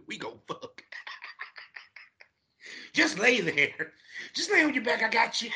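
high, coaxing woman's voice, laughing; imitating a woman. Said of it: "She like".

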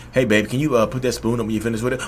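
soft, low, offhand ask; mock casual request. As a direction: imitate a partner's mild little request. Voices partner's mild request.

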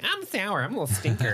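high cutesy caterpillar voice; cute little caterpillar voice. Pretend. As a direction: squeak it out like a tiny grumpy bug. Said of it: "isn't that a little, like".

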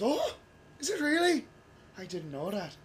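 high-pitched Irish nanny, taken aback. Retold or imitated. Irish nanny reacting to the server's made-up law, surprised Irish accent. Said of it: "I took my Irish nanny".

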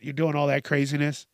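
normal talking voice

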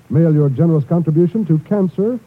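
normal talking voice

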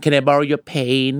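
"pain" with a long ay for pen, very slow; mispronounced pen. Shown as a demonstration. Teacher mimics learners asking to borrow a pen, "pain" with long ay. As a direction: stretch the vowel of "pain" where pen is meant. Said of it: "บางคนนึกว่าจะมีแต่สระเอ".

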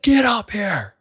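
high, angry order; imitating a yelling teacher; mock scream. Teacher orders student to the front, high angry yell. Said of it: "he's screaming at my face".

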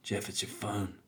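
low, hushed tempting voice; phone softly calling. Acted. A phone tempting its addicted owner, softly calling. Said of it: "the phone starts calling to you".

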